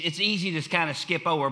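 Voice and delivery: usual speaking voice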